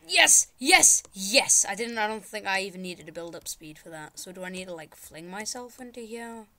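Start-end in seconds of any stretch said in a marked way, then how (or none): 0.1-1.7 loud, punched cries of success; triumphant. Real, not acted. Player finally gets through the level, triumphant. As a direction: celebrate each yes loudly.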